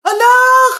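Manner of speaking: put-on loud, high wail for the child; mock despair yelling; anguished scream. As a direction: cry out in hammed-up grief. Mother sees child dead.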